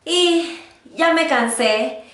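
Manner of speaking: usual speaking voice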